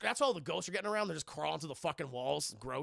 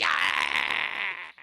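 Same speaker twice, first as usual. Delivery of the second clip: mock demon yell; 8 dB louder than usual. Demon banished.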